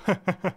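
high bright ha-ha-ha laugh; joyous laugh. Acted. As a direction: laugh out in pure relief. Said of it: "Це й справді був чудовий сміх". (радісний сміх)

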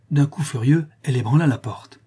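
ordinary delivery, nothing marked

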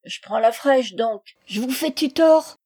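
loud, hoarse vagabond retort; a touch of hoarseness, defiant. Acted. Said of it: "Il répondit d'une voix enrouée".

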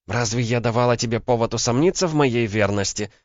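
high-pitched, voiced indignation; hissed indignation. A narrator's reading. Woman asking whether he doubts her fidelity, indignant hiss. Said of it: "прошипела Ванда".

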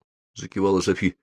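ordinary delivery

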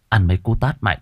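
ordinary delivery, nothing marked

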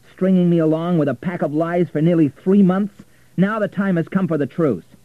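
loud, high, furious accusation; angry. A narrator's reading. Richard accusing Florentina of lying, angry and loud. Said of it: "never seen Richard angry before".

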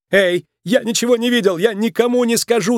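high, hurried pleading cry; panicked cry. A narrator's reading. Nick struggles in the bears' grip, crying out in panic. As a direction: cry out while squirming to get free. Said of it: "закричал Ник".

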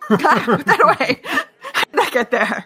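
laughing, high and tense; laughing panic. Acted. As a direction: giggle through a panicked scolding. Hissing at self over dagger.

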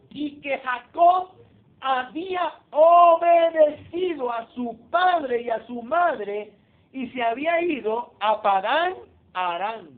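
loud, slow scripture reading; preaching at full force. Acted. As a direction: declaim the verse to the congregation.

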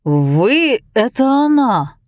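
slow tipsy recognition; drunk surprise. Acted. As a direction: realise it, drunk and amazed. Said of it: "сказал мужчина".

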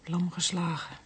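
zacht, toonloos gefluisterd; fluisterend verdriet. Acted. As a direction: fluister het, verdoofd van verdriet. Grieving daughter after father's death. (dochter fluistert verslagen na de dood van haar vader)

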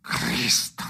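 loud sudden oath; a cry of despair breaking the silence; sharp curse. Acted. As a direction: spit out the curse. Sudden outburst after silence.

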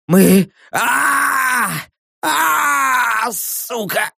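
high, desperate cries with long screams; agonised wailing. Acted. Prisoner begs the captor to kill him, wailing. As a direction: wail each phrase, stretched out in pain.

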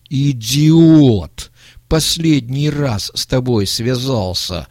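loud, drawn-out outburst; angry shout. A narrator's reading. Old man cursing his accomplice, shouting. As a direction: explode at a useless partner, slowly. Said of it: "закричал старик".

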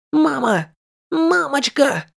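gagged desperate cry; calling mama through a gag; muffled by hand. Retold or imitated. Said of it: "пытался кричать сквозь кляп". (крик сквозь кляп)

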